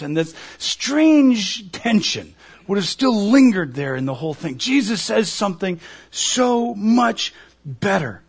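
ordinary talk

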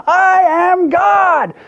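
very high, slow, loud mock yell; Shirley MacLaine shouting. Actress trying harder for her guru, mock shout. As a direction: belt it out hammed-up, each word stretched. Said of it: "Say it like you mean it".

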